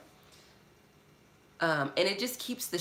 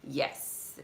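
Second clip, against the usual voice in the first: normal talking voice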